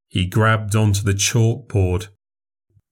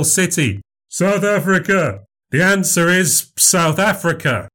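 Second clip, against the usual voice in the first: higher, bright showman voice performing for a crowd; showman memory act; 8 semitones higher than usual. Acted. Memorizer answers capitals.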